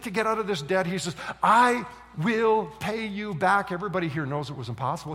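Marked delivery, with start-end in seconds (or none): 1.4-3.7 slow, higher pleading promise; begging. Acted. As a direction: plead it out, word by word. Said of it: "he begins to beg".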